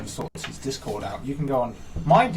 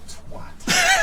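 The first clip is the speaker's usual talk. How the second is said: very high, squeaky and louder; high squeaky insult; 5 dB louder than usual. Acted. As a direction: squeak it like a cartoon character. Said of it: "I just have the urge to go".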